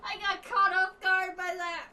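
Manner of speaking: high, breathless laughing through words; can't stop laughing; laughing hysterically. Real, not acted. Breaks down laughing after impression.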